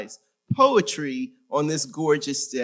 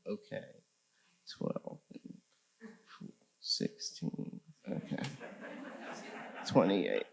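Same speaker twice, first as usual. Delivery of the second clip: muttering to herself; 5 semitones lower, 15 dB quieter than usual. Real, not acted. Checking her time, counting aloud.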